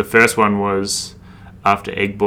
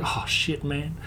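hushed reaction; 6 semitones higher, 9 dB quieter, slower than usual. Retold or imitated. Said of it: "I was like".

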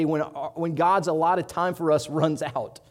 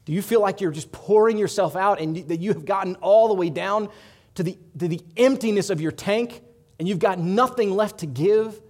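louder, higher, pressing questions; rising passion; 5 semitones higher, 8 dB louder than usual. Acted. Preacher pleading with the congregation, rising passion. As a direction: build the urgency with each clause.

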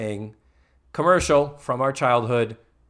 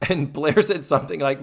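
ordinary talk

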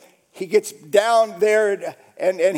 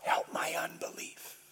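hushed, voiceless plea; whispered prayer; 2 semitones lower, 16 dB quieter than usual. Retold or imitated. Father praying out his doubt, whispered prayer. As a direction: pray it in a bare whisper. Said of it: "praying out his doubt".

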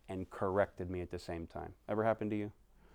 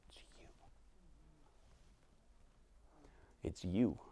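hushed prayer; 5 semitones higher, 14 dB quieter than usual. Acted. Intimate prayer to God.

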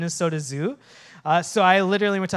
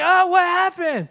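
high, loud imitation of a shocked girl; mock-distressed, pitched way up; alarmed question; 11 semitones higher, 5 dB louder than usual. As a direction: act out bewildered alarm. Injured girl's alarmed question, acted.

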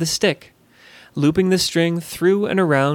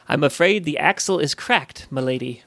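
tag. Acted. Voices the grim coachman.

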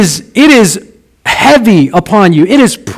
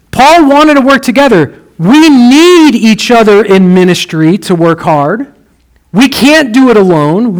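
ordinary talk

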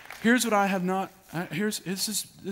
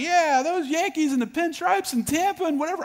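joking reply; 6 dB louder than usual. Retold or imitated. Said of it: "I was like".

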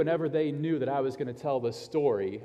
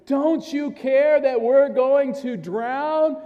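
loud, high, drawn-out shout; panicked cry; 10 semitones higher, 7 dB louder, much slower than usual. Acted. Disciples waking Jesus in the storm, acted loud desperate shout. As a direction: cry out over a storm to wake a sleeper.

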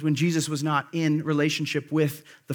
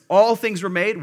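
louder, higher, with "All" punched; emphatic stress on ALL; 5 semitones higher, 7 dB louder than usual. Shown as a demonstration. Preacher repeating the verse for emphasis, stress on ALL. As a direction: drive hard on the word "All". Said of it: "Again, double emphasis on this".

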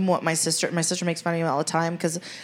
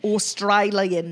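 slow, drawn-out Australian accent on "Australian"; much slower than usual. Shown as a demonstration. Trying it for a co-host, stretched Australian accent. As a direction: attempt a broad Australian "Australian". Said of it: "Does it sound like it?".

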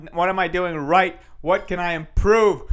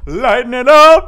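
1 loud cheerful call; 6 semitones higher, 12 dB louder than usual. Shown as a demonstration. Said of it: "I wanna bring the mood up".

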